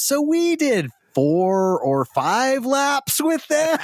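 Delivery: high, slow and laughing; spoken through rising laughter, stretched out; laughing punchline. Real, not acted.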